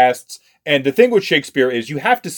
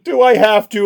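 whiny student; 9 semitones higher, 4 dB louder than usual. Retold or imitated. Voicing reluctant students.

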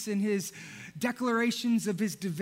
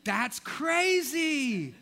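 mock amazement; 6 semitones higher, 4 dB louder, much slower than usual. Friend reacts to the roof idea.

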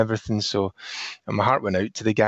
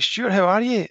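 cheery greeting; 10 semitones higher than usual. Retold or imitated. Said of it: "I was like".

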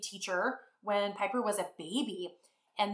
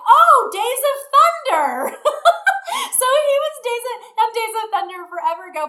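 laughing; 12 dB louder than usual. Real, not acted. Laughing at her mix-up.

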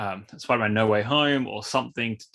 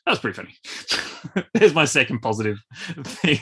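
ordinary talk, nothing marked

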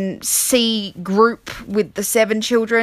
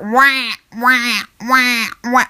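clumsy wailing alarm imitation; badly imitated alarm blare; bad iPhone alarm; 2 semitones higher, much slower than usual. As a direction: wah out a poor phone alarm. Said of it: "this is such a bad iPhone alarm sound".

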